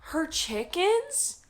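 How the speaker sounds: can't believe they'd do that; real indignant shock; outraged disbelief. Real, not acted. Outraged about killed chickens.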